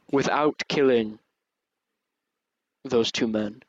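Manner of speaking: loud, grave divine judgment; a disappointed yet proud god, booming; booming dragon god. Acted. Dragon god continues judging player.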